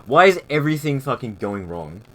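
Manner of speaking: loud exasperated outburst. Real, not acted. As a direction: burst out, fed up with everything. Stream audio keeps breaking.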